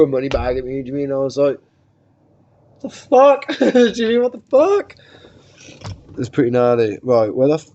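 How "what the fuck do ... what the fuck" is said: laughing outrage; outraged disbelief. Retold or imitated. Money bag thrown at him, high laughing outrage.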